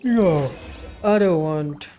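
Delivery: real, loud cry of dismay; loud protest. Real, not acted. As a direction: blurt a loud objection at the game. Alarmed reaction during game scene.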